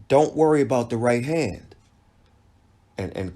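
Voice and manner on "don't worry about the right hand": raised, higher and louder quoted shout; imitated cornerman's yell. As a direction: call it out like a cornerman, moderately loud. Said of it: "he yelled".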